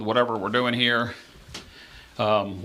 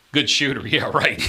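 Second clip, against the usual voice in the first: usual speaking voice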